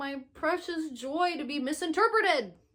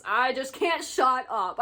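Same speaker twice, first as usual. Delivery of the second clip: usual speaking voice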